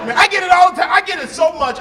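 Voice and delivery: high, loud exasperation; high loud fed-up cry; exasperated outburst. Real, not acted. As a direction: throw it up to the rafters, fed up.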